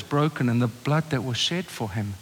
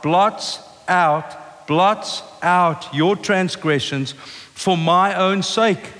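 slow, weighty scripture reading; 4 semitones higher, much slower than usual. Shown as a demonstration. Preacher reading God's promise slowly to the congregation, weighty. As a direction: dwell on each phrase of the verse. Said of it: "I'll read it to you again".

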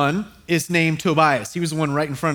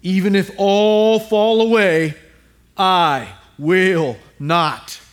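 bold vow, "I will not" drawn out slow; chest-out Peter; slower than usual. Acted. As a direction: boast defiantly, slowing hard on the denial. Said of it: "I can see him with his chest out".

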